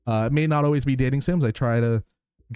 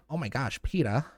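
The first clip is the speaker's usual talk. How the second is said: Lois impression. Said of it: "I haven't done Peter in a long time".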